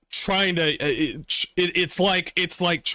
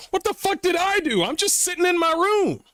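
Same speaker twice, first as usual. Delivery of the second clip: very high, indignant mock whine; innocent whine; 14 semitones higher than usual. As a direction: squeal out wounded innocence. Indignant about being hated.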